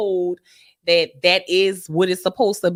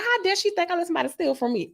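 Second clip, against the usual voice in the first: mock indignation; 7 semitones higher, faster than usual. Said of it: "I got mad 'cause I was like".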